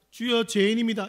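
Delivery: loud, high mock cry of repentance; imitated fervent prayer. Friend confessing to the Lord, imitated loud wail. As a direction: cry out like a penitent at a prayer meeting. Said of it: "이렇게 막 기도하기 시작하니까".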